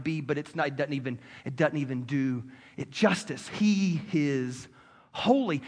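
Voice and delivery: low, soft voice of awe; quietly awestruck, searching for words; hushed reverence. Real, not acted. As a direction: drop into a hushed, reverent address to God. Hushed awe addressing God.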